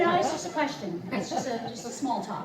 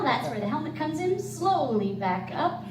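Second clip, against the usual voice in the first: ordinary talk, nothing marked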